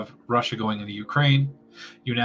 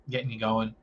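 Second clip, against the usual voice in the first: usual speaking voice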